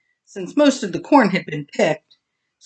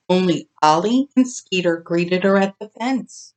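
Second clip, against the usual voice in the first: usual speaking voice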